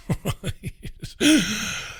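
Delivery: breathy chuckle to himself; laugh. Real, not acted. Radio host reacts to a played clip, laughing. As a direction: laugh it off quietly.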